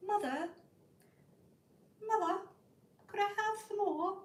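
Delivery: high-pitched put-on voice asking mother; mock-formal child's polite request; prim child pleading.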